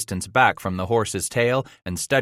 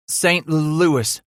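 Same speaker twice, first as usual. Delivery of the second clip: croaky voice; 8 semitones higher, 4 dB louder than usual. A narrator's reading. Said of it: "he answered with that croaky voice".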